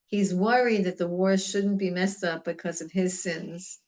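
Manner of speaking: ordinary talk, nothing marked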